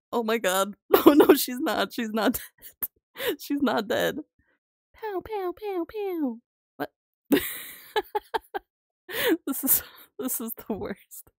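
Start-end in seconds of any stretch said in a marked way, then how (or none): 4.9-6.4 high, soft pow pow noises; playful pow sounds. Real, not acted. Streamer voices pow sounds while slapping. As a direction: make little pow noises like a cartoon.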